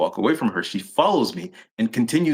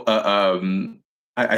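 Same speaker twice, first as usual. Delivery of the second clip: ordinary delivery, nothing marked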